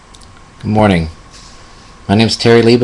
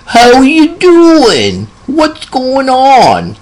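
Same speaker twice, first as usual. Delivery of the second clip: put-on very high, slowly drawn-out old man voice; little old man; much slower than usual. Dear old country man greeting his visiting nurse, little old man voice. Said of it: "he talks like this".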